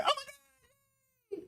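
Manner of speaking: high, slow, teary wail; mock crying. Sister hearing of the engagement, high slow mock sobbing. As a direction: sob it out like a heartbroken sister. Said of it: "she's gonna be like".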